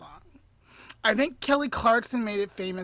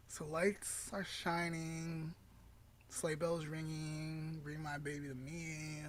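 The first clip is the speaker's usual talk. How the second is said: quiet mumble; 5 semitones lower, 16 dB quieter than usual. Real, not acted. Friend trying to recall lyrics, mumbling softly. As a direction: murmur the lyrics to yourself. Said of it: "recalling lyrics quietly".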